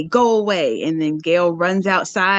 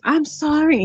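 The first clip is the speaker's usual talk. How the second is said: higher-pitched mock "sorry"; retold apology; 7 semitones higher than usual. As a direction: lift the voice for the quoted apology. Acted quote of movie character.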